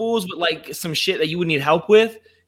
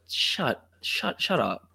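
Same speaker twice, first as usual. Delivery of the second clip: hushed disbelief; 3 semitones lower, 9 dB quieter, much slower than usual. Retold or imitated. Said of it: "And you were like".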